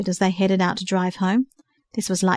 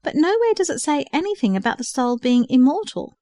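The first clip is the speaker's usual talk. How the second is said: puzzled; 6 semitones higher than usual. A narrator's reading. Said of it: "rather puzzled".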